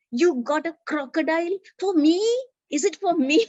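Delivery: mock-amazed child asking twice; thrilled disbelief. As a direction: squeal as if a dream came true. Boy can't believe his gift.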